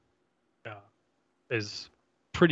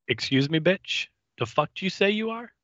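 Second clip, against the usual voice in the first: rising higher into a fast, indignant snap; Buffy squares up to Kendra, higher and faster with sass; sassy outrage; 8 semitones higher than usual. Retold or imitated. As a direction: play Buffy's offended disbelief, climbing as you go. Acted Buffy confronting Kendra.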